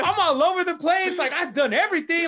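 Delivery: heated venting. Retold or imitated. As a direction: vent it like you've paid your dues and it stings. Venting about paying his dues.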